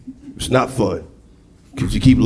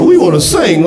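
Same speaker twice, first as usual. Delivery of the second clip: higher, louder sing-song caroler voice; mock carolers; 6 semitones higher, 4 dB louder than usual. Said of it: "talking about".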